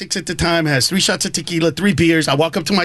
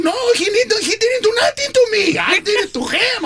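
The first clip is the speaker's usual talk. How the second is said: much higher, lightly voiced old uncle; insisting uncle; 12 semitones higher than usual. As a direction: proudly set the record straight.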